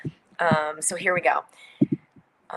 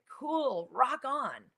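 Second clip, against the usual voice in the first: imitated easygoing approval; 6 semitones higher, 5 dB quieter, much slower than usual. Family's easygoing reply acted.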